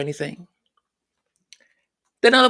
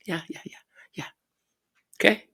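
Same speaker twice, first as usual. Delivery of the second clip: whisper; 5 dB quieter, much faster than usual. Real, not acted. Sarcastic agreement with palace claims.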